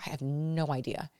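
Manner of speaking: soft, helpless I have no idea; quiet bewildered answer. Retold or imitated. Answering the onlooker, soft and bewildered. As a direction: say it quietly, lost. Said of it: "I'd be like".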